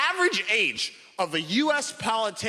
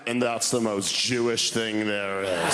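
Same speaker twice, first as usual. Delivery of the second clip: low, slow deadpan grandfather; a lightly voiced wry old man; dry grandfather; 7 semitones lower, slower than usual. Grandfather calmly delivers the punchline, low and slow. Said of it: "just went".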